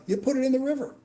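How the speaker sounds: high-pitched mock disbelief; incredulous, voice jumping high; retold incredulous complaint. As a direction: replay the indignant radio call, pitched right up. Retold complaint after a missed drop.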